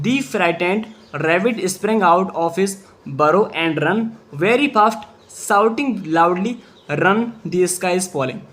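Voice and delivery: very slow, word-by-word story reading; slow dictation pace; slow read-aloud. Shown as a demonstration. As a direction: read it out slowly for students to follow. Teacher reads story for class.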